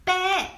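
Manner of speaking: a mock high bleating bä; boyfriend's bleat in the game. Boyfriend asking for sheep in Settlers, mock bleat. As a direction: echo the boyfriend's sheep call. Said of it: "He said he wants".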